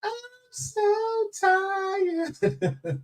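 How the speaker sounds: high, very slow, stretched-out moan; singsong exhausted complaint; drawn-out tired whine. Real, not acted. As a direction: stretch every word out high like you're worn out. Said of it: "I'm gonna be honest with y'all".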